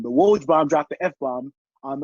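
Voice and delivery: usual speaking voice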